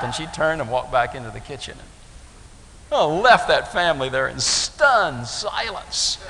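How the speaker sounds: usual speaking voice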